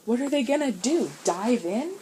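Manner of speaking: sharp. A narrator's reading. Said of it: "asked Sean sharply".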